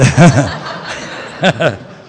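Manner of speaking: loud, high burst of laughter; real amused laugh at own story. Real, not acted. Amused by kids' question.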